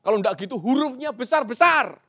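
loud, high, emphatic shout; huruf besar. Shown as a demonstration. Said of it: "itu artinya penegasan dan marah". (berseru lantang seperti huruf besar)

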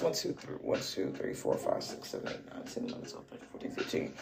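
counting the cards in a whisper. Real, not acted. Counting tarot cards to herself, in a whisper.

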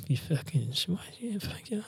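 very soft, whispered mock grumble; muttered cursing. Queuer cursing the passenger who just passed, whispered mutter. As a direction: swear under your breath, words barely formed. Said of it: "you hear every person mumble something".

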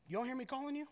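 soft, controlled challenge from a dad; leading parental question. Retold or imitated. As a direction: imitate a parent cornering the kid with a question. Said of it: "like a Jedi mind trick".

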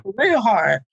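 normal talking voice

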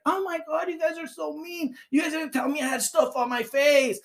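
high mock whine of a boss calling them mean; upset manager. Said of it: "she comes back".

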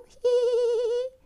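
high, loud mischievous giggle; squeaky goblin giggle. Acted. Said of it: "Erst kicherte er nur".

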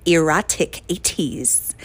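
slow "Erotic eighties" with exaggerated hard T's. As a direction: mimic the host, punching every T in "Erotic eighties". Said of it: "really pronounces those T's really hard".

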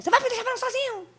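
high-pitched mock protest; high put-on voice of someone left talking alone; acted indignant partner.

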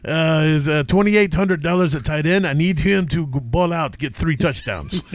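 put-on comic Russian accent. Host joking about bear-fighting players, Russian accent. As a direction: play a vodka-loving Russian, dropping the articles. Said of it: "Three is Russian".

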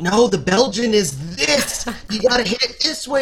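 lightly voiced demanding dialect coach; mock insistent director. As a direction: ham up the pushy coach correcting your accent. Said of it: "But then I'm being told".

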